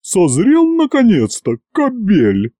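high, put-on woman's voice; high-pitched rebuke to a suitor; scolding matron. Formidable woman scolds the groom.

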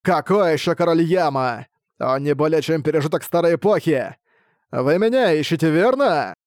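lightly voiced sinister spirit; a hint of an ominous voice from inside; booming echoing villain monologue. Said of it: "зловещий голос".

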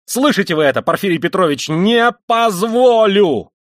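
loud, high yell ending drawn out; enraged outburst at full voice; furious shouting. A narrator's reading. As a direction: shout it, climbing to a long final cry. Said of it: "крикнул он вдруг".

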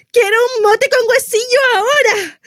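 high, tearful demand; whiny craving. Acted. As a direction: whine for it like an urgent pregnancy craving. Pregnant woman demands craved food. (antojo urgente, con lloriqueo agudo)

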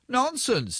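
high-pitched mock shouting; a light, high-pitched shout without real volume. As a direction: pretend to shout it down a horn. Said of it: "given to shouting".

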